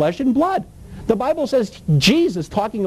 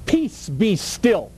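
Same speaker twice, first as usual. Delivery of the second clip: slow calm command; much slower than usual. Retold or imitated. Quoting Jesus calming storm.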